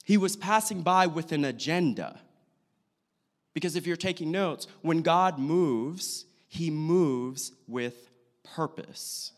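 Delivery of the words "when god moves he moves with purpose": drawn-out, deliberate key point; slow, weighty delivery; slow emphasis. Real, not acted. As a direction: stretch it out slowly for people taking notes. Key point given for note takers.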